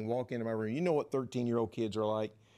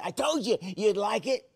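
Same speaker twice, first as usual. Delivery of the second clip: put-on giddy kid; 9 semitones higher, 5 dB louder than usual. Giddy kid gloats after prank.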